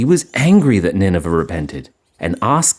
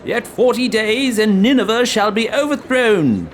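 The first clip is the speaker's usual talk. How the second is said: prophet proclaiming doom; 13 semitones higher than usual. A narrator's reading. Prophet warns Nineveh of its overthrow, proclaiming. Prophet's warning cried out to the city.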